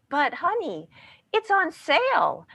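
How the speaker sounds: high, slow, rising whine; adult whining. Retold or imitated. Shopper whines to spouse to buy the shirt on sale. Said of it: "Our voice goes up. We start to whine".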